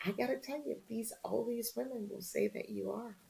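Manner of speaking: hushed, breathy whisper; whispered confidence. Retold or imitated. Reporter confiding to Oprah in a whisper. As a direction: lean in and whisper it. Telling Oprah what women think.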